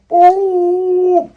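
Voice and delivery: high, loud drawn-out "boo"; long high hoot of sudden inflation; imitated balloon whoosh.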